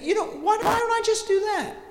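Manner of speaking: high, lively self-talk; as if it just hit you; lightly voiced eureka; sudden idea.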